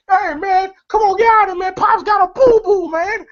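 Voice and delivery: high-pitched, whiny mock urgency; urgent pleading. [name] shooing someone out of the bathroom, urgent and high-pitched.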